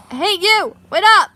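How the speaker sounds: loud shout after someone; yelling. Acted. Man hailing two friends on the path, yelling. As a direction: call out to stop them. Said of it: "You think he's yelling at us?".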